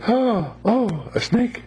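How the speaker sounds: high, imitated gasps of wonder; awed exclamation. Scouts spotting a snake, imitated amazement. Voicing what scouts wanted to say.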